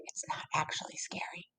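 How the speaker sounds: fast unvoiced whisper; whisper aside. Real, not acted. Reader whispering a reassuring aside to kids, unvoiced whisper.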